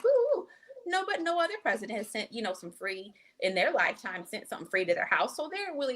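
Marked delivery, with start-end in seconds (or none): 0.0-0.5 high squealing ooh of delight; mock excited ooh. Parents thrilled about stimulus checks, squealing ooh. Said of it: "But they're like".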